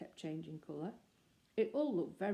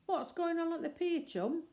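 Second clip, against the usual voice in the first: calling out; 11 semitones higher, 4 dB louder than usual. Acted. Calling out to passing man.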